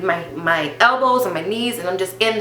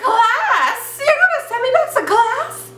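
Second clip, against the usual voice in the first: very high-pitched mock wail; hammed-up, incredulous whine; whining disbelief; 4 dB louder than usual. Student protesting to the nurse about class, high whiny disbelief. Acted protest at being sent back.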